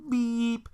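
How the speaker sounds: high slow beep like a car horn. Retold or imitated. Driver honking at a stopped lady, high drawn beep. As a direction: squeak out a horn honk.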